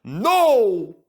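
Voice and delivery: loud desperate plea; pleading. Acted. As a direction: beg not to be replaced. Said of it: "pleaded Skarloey".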